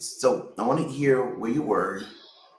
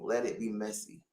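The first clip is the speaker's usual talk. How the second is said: hushed echo of a slogan; softer repeat; 2 semitones lower, 11 dB quieter than usual. Real, not acted. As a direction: let the words settle quietly.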